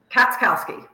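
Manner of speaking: "Katskowski" said clearly and deliberately as KATS-KOW-ski, falling at the end; correct name pronunciation. Shown as a demonstration. Guest pronounces her own surname for the audience, slow and clear. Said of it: "last name for the audience so we can hear it correctly pronounced".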